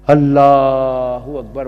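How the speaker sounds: slow, stretched, reverent cry; drawn-out exclamation. Real, not acted. Preacher moved by the Prophet's story, drawn-out exclamation. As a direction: draw out Allah Akbar in awe.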